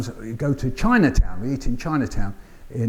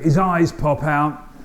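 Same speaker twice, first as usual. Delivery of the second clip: normal talking voice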